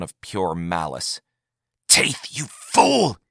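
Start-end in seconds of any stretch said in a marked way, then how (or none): 1.9-3.2 Yagar hisses at Taith, high and slow; hissed. A narrator's reading. Yagar hisses at the fool Taith. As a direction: spit the insult at her, slowly. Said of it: "he hissed".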